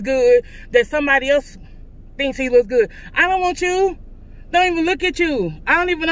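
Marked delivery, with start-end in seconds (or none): none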